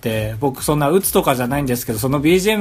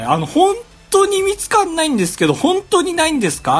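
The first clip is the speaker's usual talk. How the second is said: high, polite voice for a phone call; a caller politely asking a company; high-pitched phone politeness; 13 semitones higher than usual. Retold or imitated. Retelling his phone call.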